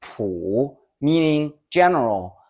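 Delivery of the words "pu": "pu" on a low dipping tone; "pu" in Mandarin third tone. Shown as a demonstration. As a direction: dip down low on "pu". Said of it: "With the third tone".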